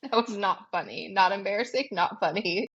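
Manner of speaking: ordinary delivery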